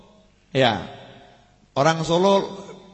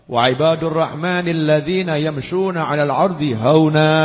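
chanted Arabic verse in a lower, recited tone; Quranic recitation; 3 semitones lower than usual. Acted. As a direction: intone the verse like tilawah.

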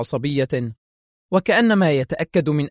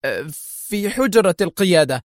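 nervous, higher-pitched answer; trembling words; 4 semitones higher than usual. A narrator's reading. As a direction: confess haltingly, rattled. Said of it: "ارتجفت الكلمات على شفتي دوناهيو".

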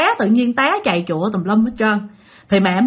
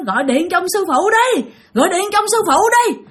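much higher, insistent calling out; yelling demand; 8 semitones higher than usual. Retold or imitated. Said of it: "ba la làng lên".

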